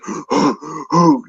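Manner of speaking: mock exhausted gasp; imitated hard breathing. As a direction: gasp like a tired fighter out of air. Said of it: "breathing hard, like".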